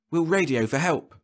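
a hint of a hopeful captain; Burnett, suggesting. Burnett proposing to call for help, lightly voiced.

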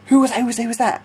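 high, urgent rapid repeats; retold startled questioning. Retold alarm as tent falls.

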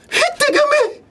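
very high-pitched mock startled squeal. Mnemonic as startled cry at crowds. (아주 높게 꽥 지르는 놀란 소리)

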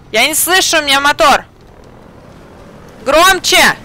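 loud, high call over an engine; shouting over noise. Real, not acted. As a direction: yell to be heard.

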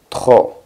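trop said "tro" with throaty French R; silent final P. Shown as a demonstration. Teacher showing a silent consonant, trop without final P. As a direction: end trop on the O, no P sound. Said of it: "La P ... no suena".

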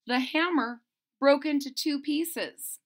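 ordinary delivery, nothing marked

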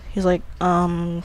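usual speaking voice

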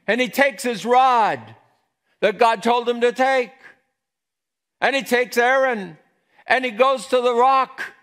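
loud, high, heated storytelling; full-force preaching. Acted. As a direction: preach it at a near shout.

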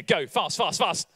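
ordinary talk, nothing marked

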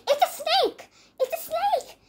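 high-pitched storybook cry of alarm; shouting. A narrator's reading. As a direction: shout a warning in acted panic. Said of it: "he shouted".

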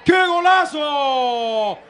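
stretched roaring goal call; long, loud goal scream; breakneck goal commentary. Real, not acted.

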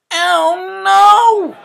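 loud, high shout of dismay; he sees the mixed-up town, shocked; dismayed cry. A narrator's reading. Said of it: "he said".

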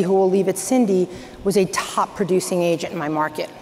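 ordinary talk, nothing marked

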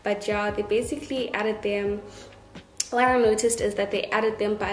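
speaking around new brackets; slight lisp from braces; talking with braces. Real, not acted. Seen 5: Metal brackets glint on teeth when mouth opens; absent in refs.

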